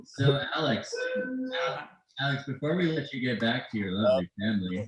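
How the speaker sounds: faint delayed echo of the voice; distant repeat, like a stream lag. Real, not acted. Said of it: "we have a big echo in here".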